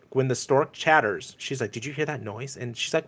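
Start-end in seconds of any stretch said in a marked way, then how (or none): 1.7-2.6 much softer, out of character; normal non-sitcom tones. Retold or imitated. Retelling the moment the stork chatters, normal non-sitcom tones. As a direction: imitate a sitcom actor dropping the act, hushed. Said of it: "not sitcom-y tones".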